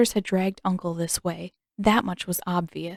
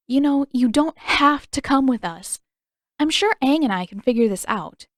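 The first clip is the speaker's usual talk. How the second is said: put-on annoyed girl voice; 6 semitones higher, 5 dB louder than usual.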